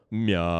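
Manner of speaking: very long meow swooping over two hills of pitch; double coaster meow. Shown as a demonstration. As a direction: ride one meow up, down and up. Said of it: "We're gonna do a double coaster".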